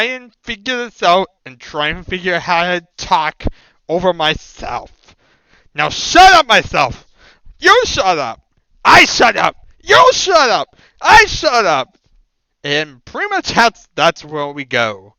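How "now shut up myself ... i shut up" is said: two loud voices snapping back and forth; bickering voices in one. Pretend. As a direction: shout it out as a spat with yourself. Arguing with himself aloud.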